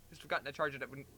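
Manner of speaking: plain reading voice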